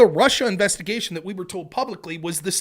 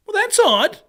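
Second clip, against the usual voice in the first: high, loud sarcastic well that's odd; mock-naive sarcastic high tone; mock surprise; 12 semitones higher, 6 dB louder than usual. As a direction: feign innocent puzzlement. Sarcastic aside on the spy.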